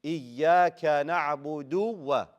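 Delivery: "Iyaka na'budu wa" slow with strong "du" before "wa"; dhamma pushed out. Shown as a demonstration. Teacher models for students, slow clear dhamma. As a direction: separate a full "du" from "wa". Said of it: "Push out that do".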